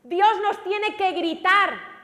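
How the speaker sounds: slow, loud, high cry; loud preaching. Real, not acted. Preacher crying out to the congregation, loud. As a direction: cry it out to the whole church. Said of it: "yo le di voces".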